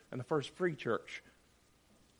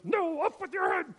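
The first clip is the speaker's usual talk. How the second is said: high, loud mock tyrant retort; imitating a dictator's louder counter-order; rival dictator; 5 dB louder than usual. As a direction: shout it back like a second petty dictator. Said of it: "And the other one goes".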